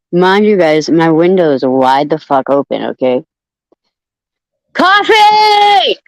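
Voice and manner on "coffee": shouting a name. Real, not acted. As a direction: yell the name to wake him. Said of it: "I'm gonna sound like".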